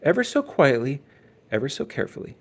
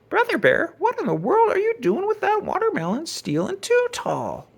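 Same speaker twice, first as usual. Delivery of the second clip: put-on surprised old farmer. A narrator's reading. Farmer surprised to find Brother.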